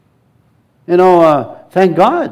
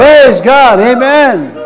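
louder, exultant amen; loud joyful praise; 7 semitones higher, 5 dB louder, much slower than usual. Real, not acted. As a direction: close the praise with joy. End of praise break.

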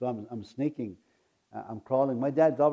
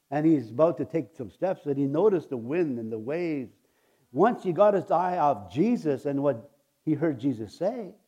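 ordinary talk

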